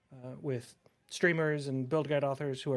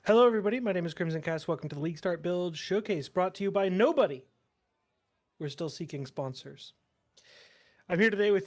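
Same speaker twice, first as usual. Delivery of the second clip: bright, upbeat host intro; YouTuber voice; 5 dB louder than usual. Pretend. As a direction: open like a hyped YouTube host. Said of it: "turn on YouTuber voice for a second".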